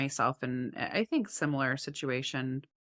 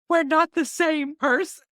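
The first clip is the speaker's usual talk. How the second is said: high, loud mock teen whine; shrill teen son; 12 semitones higher, 9 dB louder than usual. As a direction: squeal it like a fed-up teenager.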